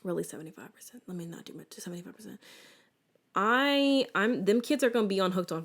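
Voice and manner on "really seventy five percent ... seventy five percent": much softer, under the breath; muttered aside. Real, not acted. Vlogger correcting herself, softly muttered aside.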